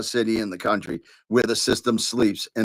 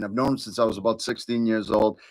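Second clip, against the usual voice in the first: ordinary talk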